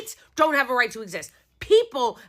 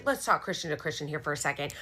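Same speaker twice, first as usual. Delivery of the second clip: mock confidential; 9 semitones lower, 9 dB quieter, faster than usual. Mock-friendly aside to politician.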